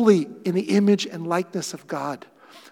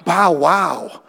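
mock disgusted groan; 3 semitones lower, 6 dB louder, slower than usual. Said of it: "He didn't go".

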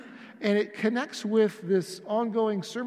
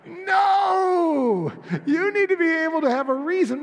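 long, high, loud "No!" easing into loud insistence; exasperated shout; 9 semitones higher, 6 dB louder than usual. Acted. Pastor objects to passing on his phone number, exasperated shout. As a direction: cry out in protest, drawn out, then press the point. Mock outburst at a weak answer.

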